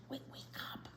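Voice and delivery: very soft urgent whisper. Acted. Luigi nudging dozing Grandpa during the opera, in an urgent whisper. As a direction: breathe it to wake him quietly.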